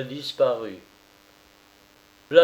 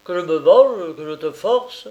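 much louder, through gritted teeth; violent, through gritted teeth; 4 semitones higher, 8 dB louder than usual. A narrator's reading. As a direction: threaten her with violence. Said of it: "avec violence en grinçant les dents".

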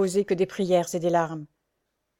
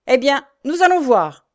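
loud, high exclamation; shouted challenge; 6 semitones higher, 9 dB louder than usual. Acted. Jacques taking up his brother's threat, shouting. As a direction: cry out, ready to fight. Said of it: "s'écria Jacques".

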